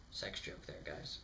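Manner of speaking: whispered joke to the audience; whispered aside. Real, not acted. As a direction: breathe it out as a secret aside. Aside to viewers.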